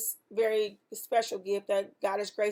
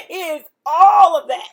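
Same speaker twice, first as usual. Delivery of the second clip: much louder and higher, laughing through tearful joy; overjoyed laughing cry of praise; 6 semitones higher, 14 dB louder than usual. Real, not acted. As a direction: burst out laughing, almost crying with joy. Overwhelmed joy over answered prayer.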